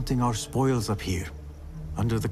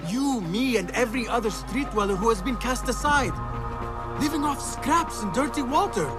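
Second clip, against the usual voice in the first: impassioned plea; 9 semitones higher than usual. Acted.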